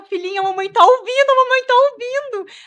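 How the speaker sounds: put-on high, loud, tearful calling out to her baby; retold shout to newborn. Mother in the delivery room calling out to her crying newborn, loud and high. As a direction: call out to your newborn loud and high, voice breaking. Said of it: "Não grita".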